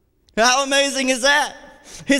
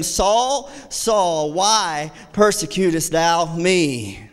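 solemn heavenly voice; 8 semitones lower, much slower than usual. Acted. Voice of Jesus from heaven.

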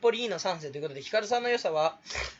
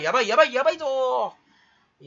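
hammed-up panic; 4 semitones higher, 7 dB louder than usual. Mock panic building to a drawn-out cry.

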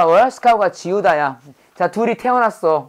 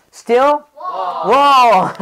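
slow drawn out; 5 semitones higher, 3 dB louder, much slower than usual. Shown as a demonstration. Teacher performs the word slowly for class. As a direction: still 워 stretched very slowly. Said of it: "[name]이 갑니다".

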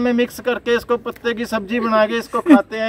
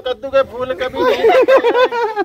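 vendor sales pitch; 9 semitones higher, 4 dB louder than usual. Pretend.

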